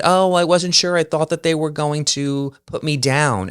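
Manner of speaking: teasing impression of a worried friend; imitating Lisa. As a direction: play her as timid and a bit silly. Friend Lisa voiced in retold story.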